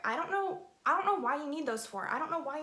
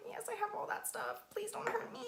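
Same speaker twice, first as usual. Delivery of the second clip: high, soft, frightened little voice; mock timid plea; 10 semitones higher, 8 dB quieter than usual. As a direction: act out meekly begging the cop for mercy. Acts out timid plea to cop.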